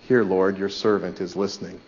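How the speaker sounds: soft, low and yielding; preacher softly voices a yielding prayer, hushed; hushed surrender. Shown as a demonstration. Preacher voicing the congregation's surrender to God, hushed and yielding. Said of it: "give in and say".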